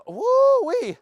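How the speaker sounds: very high, loud whoop; excited whoop. Real, not acted. Trainer whooping mid-sentence, excited. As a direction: let out a gleeful whoop.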